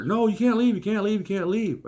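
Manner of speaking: high-pitched frantic pleading; mock panic. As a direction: repeat it in a rush of high-voiced alarm. Salesman stops them walking out.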